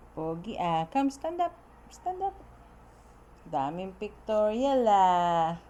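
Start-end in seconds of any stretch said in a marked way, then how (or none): 0.9-1.6 coaxing. Real, not acted. Coaxing baby to stand. 1.9-2.4 soft coaxing. Real, not acted. Coaxing baby to stand.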